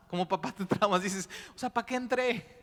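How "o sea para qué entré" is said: high, hammed-up panic; a comic, half-joking freak-out; mock panicked dad. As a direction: play the traumatized new father.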